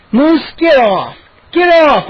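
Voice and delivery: loud, high pleading yell; wail. A narrator's reading. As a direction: wail it, stretched out and rising in pitch. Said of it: "I wailed".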